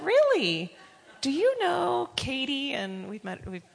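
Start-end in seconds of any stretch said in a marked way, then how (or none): 0.0-2.7 high, loud surprised exclamation. Retold or imitated. Couple asking the pastor about their friend, surprised. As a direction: leap up in delighted disbelief.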